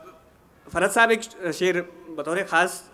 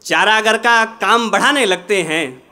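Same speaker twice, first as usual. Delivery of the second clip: measured, projected ghazal recitation with pauses; poetry recitation; 4 semitones higher, 8 dB louder than usual. Acted. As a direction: recite the couplet to a mushaira audience.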